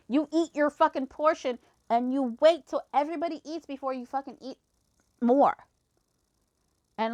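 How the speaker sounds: retold rant, heated and emphatic; going off. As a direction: lay down the rule indignantly. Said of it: "I just went off".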